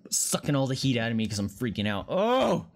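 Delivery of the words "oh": sudden high startled gasp. Real, not acted. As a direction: jump at a scare. Startled by horror game.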